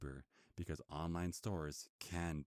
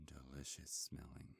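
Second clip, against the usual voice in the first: savouring whisper; 7 dB quieter than usual. Acted. Werewolf to the vampire he craves, a hungry whisper. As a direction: breathe it out like a hungry predator.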